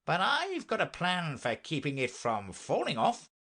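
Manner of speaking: high, swooping knight voice; eager knight. Acted. Knight offers his hair plan to Alice, eager and lilting. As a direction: announce it proudly with lifted pitch.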